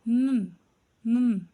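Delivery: नन said loudly as a nasal n; n sound. Shown as a demonstration. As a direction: stress नन twice, humming the n. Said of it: "एन आवाज़ देता है". (नन ज़ोर से, नाक से न की आवाज़)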